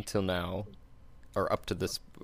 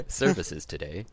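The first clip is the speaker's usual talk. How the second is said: customer service voice; 6 dB louder than usual. Pretend. Mock tech support survey.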